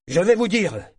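sudden high, loud opening; abrupt announcement. A narrator's reading. Cornered bear trainer turns to the hostile crowd, abrupt. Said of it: "fit-il brusquement".

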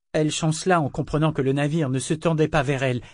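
plain reading voice

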